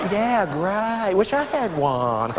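drawn-out, sinking mock-polite reply; insincere approval. Guests answering her excuse for the shawl, insincere. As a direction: trail down slowly, humoring her.

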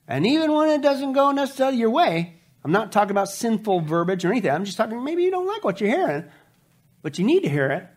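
ordinary talk, nothing marked

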